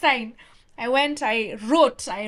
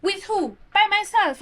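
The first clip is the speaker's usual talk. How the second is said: higher, eager replies fired back fast; eager quick answers; 10 semitones higher, 4 dB louder than usual. Retold or imitated. Student answering the boss's questions, eager and high. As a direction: pitch each answer up with excited pride. Retelling quick exchange with the boss.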